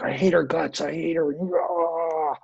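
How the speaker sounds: mock angry rant. Teacher mimics being lost in anger. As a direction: play the angry thought as if swept up in it.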